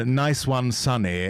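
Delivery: ordinary talk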